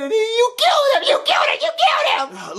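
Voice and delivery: high, screechy sobbing accusation; hysterical crying. Acted. Boy blaming cook for friend's death, hysterical. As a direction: wail the blame over and over.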